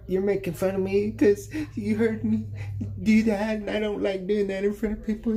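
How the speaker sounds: high put-on little sister voice, embarrassed. Brother voicing his embarrassed sister, high and whiny.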